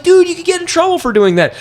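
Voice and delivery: high mock-parent scolding; alarmed warning. Dad warns son about the lock, high alarmed warning. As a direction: pitch it way up, imitating a worried dad.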